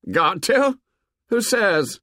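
high scornful retort. A narrator's reading. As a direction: sneer the question back. Jack sneers back.

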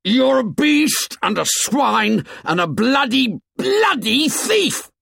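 very high, loud screamed insult; screaming. A narrator's reading. Ralph screams insults at Jack, temper snapped. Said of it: "He screamed at Jack".

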